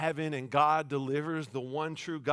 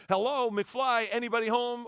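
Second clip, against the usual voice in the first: mocking taunt; 8 semitones higher, 4 dB louder than usual. Elijah taunting the prophets.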